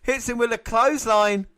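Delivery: high, loud call through laughter; excited, amused play-by-play; laughing commentary. Real, not acted. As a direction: laugh out the big hit. Laughing at a big hit.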